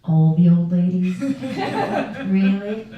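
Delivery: loud, laughing "really?"; giggling at the absurd news; laughing disbelief. Real, not acted. Laughing disbelief at nurse's report.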